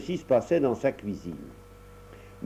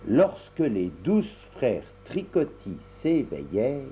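ordinary delivery, nothing marked

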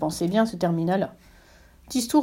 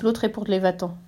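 ordinary delivery, nothing marked

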